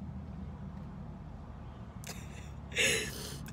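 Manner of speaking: hushed breathy crying; quiet sob. Real, not acted. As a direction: cry softly into the pause. Crying after the acceptance email.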